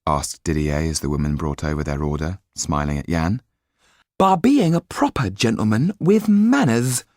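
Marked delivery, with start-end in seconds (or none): 4.2-7.1 high-pitched, pointed woman's reply; emphatic. A narrator's reading. Cafe woman praises Yan's manners to Didier, emphatic. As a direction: lean firmly on the words, pitched high. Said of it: "said the woman with emphasis".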